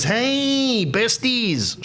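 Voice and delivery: squealed high hey besties, bubbly and bright; a bubbly sister gushing at the girlfriend; gushing greeting. Retold or imitated. Sister greeting new girlfriend, high and gushing. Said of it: "she goes".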